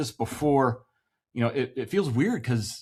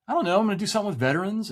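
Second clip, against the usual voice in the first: eager answer; 8 semitones higher, much faster than usual. Retold or imitated. Phone call with his brother.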